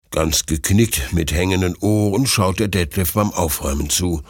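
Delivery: ordinary delivery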